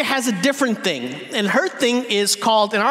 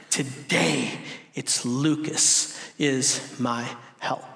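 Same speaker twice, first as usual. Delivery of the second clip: soft, low musing with pauses; lightly acted, quietly weighing each name; daughter seeking help; 6 semitones lower, 6 dB quieter, slower than usual. Toddler running through family for help, soft deliberation. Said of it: "she looked to the sources of help".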